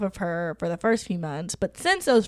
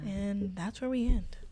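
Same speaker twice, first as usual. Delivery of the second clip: whispered; 9 dB quieter than usual. Real, not acted. Whispered ending.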